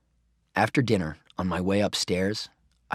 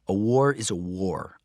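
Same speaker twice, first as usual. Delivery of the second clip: ordinary delivery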